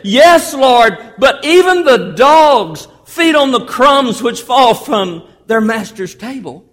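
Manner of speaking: high, loud, drawn-out appeal; impassioned acted reply; insistent plea. Woman pleads back to Jesus.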